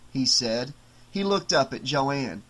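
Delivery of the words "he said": ordinary delivery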